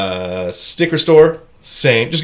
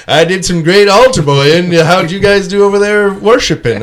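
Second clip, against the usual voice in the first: put-on higher and louder, chirpy boy's voice; mock altar boy [name]; 7 semitones higher, 8 dB louder than usual. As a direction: play a proud little altar boy bragging. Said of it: "I was like".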